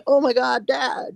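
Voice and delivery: very high imitation of a delighted child; excited son. Son spotting his dad from the playground, imitated excited child. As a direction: mimic a kid calling out, far up in pitch. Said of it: "my son's in the playground. He's like".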